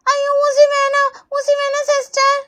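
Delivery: put-on high, slow child whine; scared whining. Child begs nurse just before the needle, scared whining. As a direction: drag out the plea like a scared kid.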